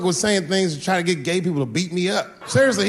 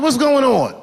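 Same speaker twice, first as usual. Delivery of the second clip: alarmed question; 9 semitones higher, 8 dB louder than usual. Retold or imitated. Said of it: "I said".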